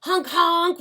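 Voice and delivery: loud, high honking; car horn honks. Acted. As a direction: imitate a blaring car horn. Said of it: "went the horns".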